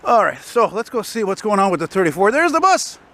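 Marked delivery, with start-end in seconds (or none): 2.3-3.0 sudden high-pitched exclamation; excited sighting. Real, not acted. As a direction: call it out as the bus appears. Spots his bus arriving mid-sentence.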